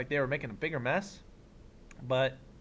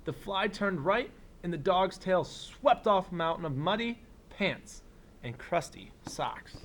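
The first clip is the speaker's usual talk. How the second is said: put-on reading to children; 2 semitones higher than usual. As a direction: read it like story time for a class. Reads picture book aloud.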